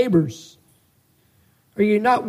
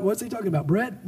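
disciples' thought, voiced much softer; puzzled aside; 9 dB quieter, faster than usual. Preacher voices the disciples' confused thought, softer puzzled aside. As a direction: drop the volume for a confused private question.

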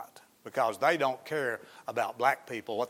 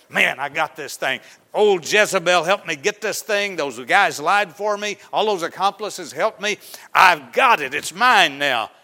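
gloating; 5 semitones higher, 8 dB louder than usual. Acted. Ahab gloating in stolen vineyard.

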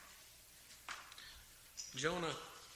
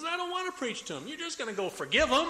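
much higher, loud sulky protest; whiny angry refusal; 9 semitones higher, 7 dB louder than usual. Retold or imitated. Jonah protesting to God he'll forgive them, loud. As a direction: complain like a sulking child.

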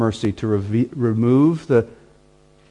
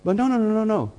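defensive churchgoer; 9 semitones higher than usual. Retold or imitated.

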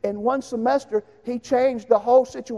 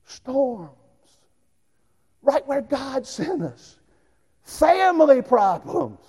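slow preaching; 5 semitones higher, much slower than usual. Acted. Preacher's slow dramatic list.

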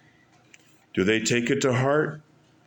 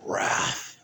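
whispered; 4 dB quieter than usual. Acted. Dramatic reveal of crowd's reaction.

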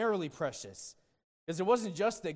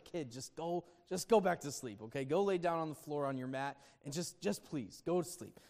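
put-on tired old Eli; 3 semitones lower, 6 dB quieter than usual. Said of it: "He's like".

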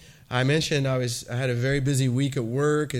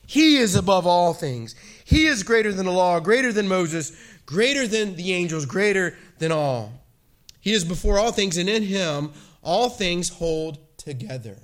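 raised, fervent pulpit voice; preaching; 5 semitones higher, 3 dB louder than usual. Real, not acted. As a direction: preach it with full conviction. Preaching on Colossians.

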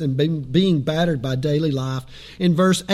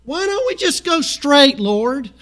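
high, loud, drawn-out protest to the Lord; mock pleading complaint; 8 semitones higher, 5 dB louder, slower than usual. Hiker on switchbacks questioning the Lord, mock pleading. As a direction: complain upward in playful exasperation. Acts a complaining plea to God.

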